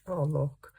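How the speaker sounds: usual speaking voice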